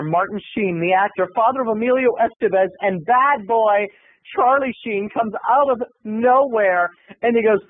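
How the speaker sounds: ordinary talk, nothing marked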